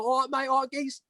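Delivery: light put-on Cockney accent, cheeky; a hint of a Cockney geezer; mock Cockney. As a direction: lad in the pub doing a Cockney accent. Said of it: "then I turn all Cockney".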